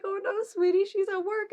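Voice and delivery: high, loud sweet adult voice; sweet mom voice. Retold or imitated. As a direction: answer a child gently. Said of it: "And it's like".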